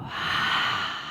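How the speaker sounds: long audible sigh out through an open mouth; breathy exhale sigh. Shown as a demonstration. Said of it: "long exhale as you release".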